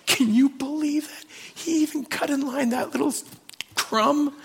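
a wronged man grumbling in the queue; mock indignant. Pastor grumbling about Frank cutting in line, high indignant.

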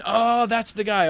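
loud, high, delighted call; light up on spotting him; excited recognition. Acted. Watchers see the good-news messenger coming, excited call.

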